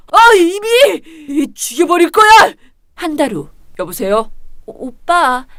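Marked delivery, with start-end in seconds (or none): 0.1-2.3 high, loud threat; a furious sister losing control; enraged scream. Acted. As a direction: scream that you'll kill her.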